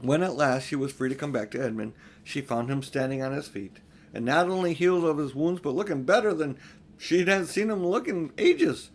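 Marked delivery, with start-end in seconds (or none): none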